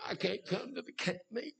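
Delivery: high, soft put-on whimper; mock whining. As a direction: make a feeble excuse in a mocking little whine. Mocks complaining churchgoers.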